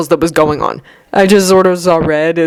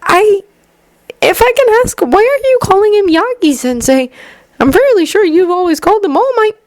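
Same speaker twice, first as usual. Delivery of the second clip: put-on gentle young man voice. A narrator's reading. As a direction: voice Izuku lightly higher, kindly. Izuku voiced by narrator.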